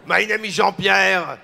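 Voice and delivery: hammed-up comedian's French accent; mock French accent. Comedian imitating a French waiter greeting diners, exaggerated French accent. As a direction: lay the cliché French accent on thick. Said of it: "doing the French accent".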